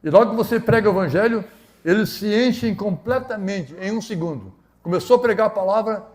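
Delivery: high, emphatic pulpit delivery; impassioned preaching. Real, not acted. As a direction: preach it forcefully, stretching the key words. Preaches at full force.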